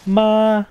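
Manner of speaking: "Ma." held on one high level pitch; high flat tone. Shown as a demonstration. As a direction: sing "Ma." flat and high like a note. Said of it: "the flat tone, which is the high tone".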